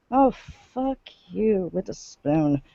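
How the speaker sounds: slow, soft, muttered disgust. Real, not acted. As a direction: mutter it with contempt. Reacting to the article.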